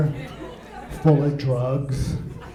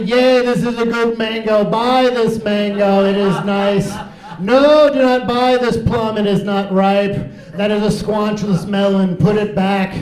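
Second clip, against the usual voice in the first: high, loud, stilted oracle voice; produce wizard; 5 semitones higher, 4 dB louder than usual. Acted. Produce wizard judging fruit for his fans, grandly. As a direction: pronounce verdicts like a grand wizard. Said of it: "And I say".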